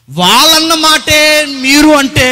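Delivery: a high, loud preaching outburst; fervent preaching. Acted. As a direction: punch it out to the congregation. Preaching burst.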